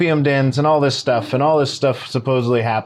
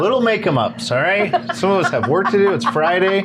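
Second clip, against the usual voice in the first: higher, quick fed-up delivery guy; annoyed worker voice; 7 semitones higher than usual. Retold or imitated. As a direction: brush them off like a tired worker. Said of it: "He's like".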